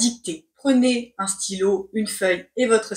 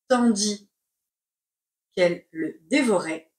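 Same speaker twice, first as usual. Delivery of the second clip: slow, chunked with a pause; slow dictation; 2 semitones lower than usual. Shown as a demonstration. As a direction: dictate word by word. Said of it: "C'est parti pour la dictée".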